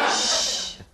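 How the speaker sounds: unvoiced shush through the teeth. Shown as a demonstration. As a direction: hush someone sharply. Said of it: "I did that little face thing".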